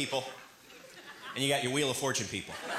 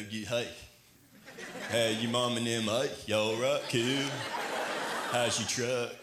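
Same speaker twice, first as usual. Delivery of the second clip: Texas drawl imitation; 5 semitones lower, slower than usual. Comic imitates Texans talking to the crowd, low Southern drawl. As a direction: lower Southern drawl, lightly done. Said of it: "I talk like that people in whatever region".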